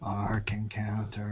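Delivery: hushed, voiceless Ark Encounter; ominous whispered echo. Real, not acted. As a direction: breathe it out like a dread secret. Echoed in a whisper.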